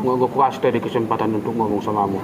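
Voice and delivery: husband humbly apologising to his wife; sheepish apology. Acted. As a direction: back down and admit you were wrong.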